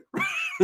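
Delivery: very high shrieking cackle; high-pitched giggle shriek; shrill laugh. Retold or imitated. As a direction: let out a squealing laugh. Ricky laughs after bragging.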